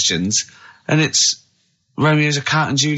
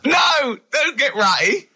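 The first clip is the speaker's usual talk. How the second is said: high-pitched shouted protest; exasperated outburst; 12 semitones higher, slower than usual. Real, not acted. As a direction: cry out in protest, then scold. Shouting down Carl's refusal to answer.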